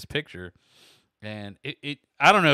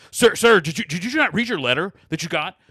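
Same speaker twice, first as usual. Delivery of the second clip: high, loud scolding from the agent; irate agent; 5 semitones higher, 9 dB louder than usual. Retold or imitated. Young agent going off on caller by phone, loud. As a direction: tell off the caller sharply. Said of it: "going off on me on the phone".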